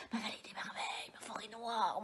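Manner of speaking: whisper. Acted. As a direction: murmur a pet name quickly. Whispered endearment.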